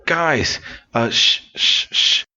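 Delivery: high stammer then hushing; stuttering warning. Acted. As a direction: stutter out the warning in a rush of fear. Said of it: "But Pete suddenly goes".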